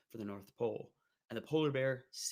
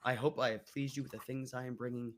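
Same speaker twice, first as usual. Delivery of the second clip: ordinary delivery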